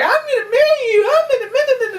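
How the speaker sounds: very high, loud mock girl voice; weird fish girl. Fish girl declaring marriage to the hero, creepy high voice. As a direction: squeal it like a creepy smitten girl. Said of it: "creeped out by weird fish girl".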